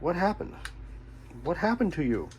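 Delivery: normal talking voice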